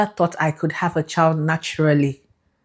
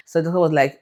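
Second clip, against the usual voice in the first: usual speaking voice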